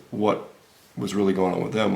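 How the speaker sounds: ordinary delivery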